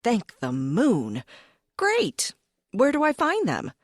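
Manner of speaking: high, bright burst of relief; excited. Acted. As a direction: leap at the good news. Character excited.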